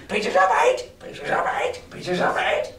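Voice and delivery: quick squawked parrot cry; squawking parrot. Acted. Parrot squawking pieces of eight in galley, squawk. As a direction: rattle it off like a parrot. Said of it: "the parrot would say with great rapidity".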